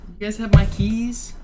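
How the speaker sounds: high, loud call from afar; yelling across the room. Real, not acted. Searching for keys off mic.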